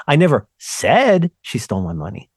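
"said" pushed up with a rising tune; stress on said. Shown as a demonstration. Demonstrating the famous sentence to his cohost, stress on "said". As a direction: lean into the word "said". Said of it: "If you stress each of those words".

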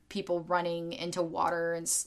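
ordinary talk, nothing marked

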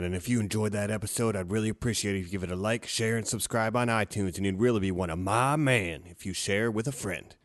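easygoing hero sign-off; Aquaman voice. Pretend.

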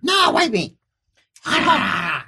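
high little creature; squeaky creature voice. Acted. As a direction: chirp it matter-of-factly. Creature answers.

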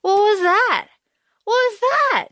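rising shock at a cliffhanger; stunned disbelief. Retold or imitated. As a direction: react like the ending hit a wall. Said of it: "I was just like".

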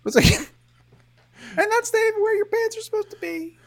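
high, giggling through the words; laughing. Real, not acted. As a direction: laugh while you say it.

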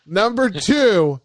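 ordinary delivery

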